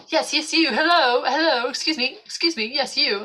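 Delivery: high, loud English hailing; eager English gent flagging someone down; English accent. Retold or imitated. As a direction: call insistently to a stranger in an English accent. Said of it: "in this English accent".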